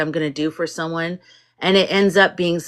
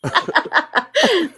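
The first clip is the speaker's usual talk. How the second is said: laugh; 11 semitones higher than usual. Real, not acted. Laughing at James's humor.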